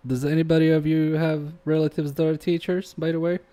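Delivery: ordinary talk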